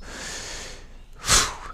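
long blown-out breath; exasperated sigh. Acted. Man giving up on the frightened walker, heavy sigh. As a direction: puff out a defeated sigh.

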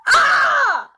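sudden high piercing shout. Shown as a demonstration. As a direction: shout over the noise to grab attention. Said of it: "I can shout".

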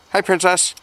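high-pitched hello to a pet chicken; pet greeting. Real, not acted. Keeper greets the hen Princess, high-pitched pet greeting.

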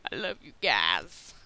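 low, loud put-on cheesy voice. Player to viewers, hammed-up cheesy affection. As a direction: ham up a corny declaration of affection. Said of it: "I do sound cheesy when I say that".

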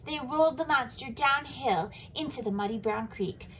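plain reading voice

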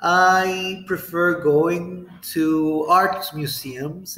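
slow, drawn-out model answer. Shown as a demonstration. Teacher slowly models a museum choice. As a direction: stretch it out for learners. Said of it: "You can say".

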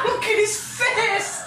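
high, drawn-out squeal through laughter; slow, high exclamation breaking out of a laugh; laughing disbelief. Real, not acted. Player seeing the zombie's smashed face, high laughing squeal.

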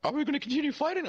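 high clown voice, milder now; Ronald McDonald. Retold or imitated. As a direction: ask it plainly in a falsetto clown voice. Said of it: "It's like".

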